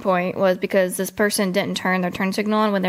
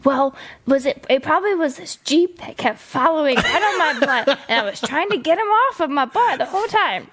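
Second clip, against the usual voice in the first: higher-pitched mimicry of a driver making excuses; lightly mocking, higher lady's voice; retold lady driver; 5 semitones higher than usual. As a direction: imitate her rambling excuse in a higher voice. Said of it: "So she was like".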